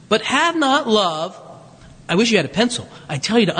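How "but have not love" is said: slow scripture refrain. Acted.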